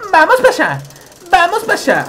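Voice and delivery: cartoon high voice; high silly voice. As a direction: squeak it out playfully. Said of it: "repeats in voices".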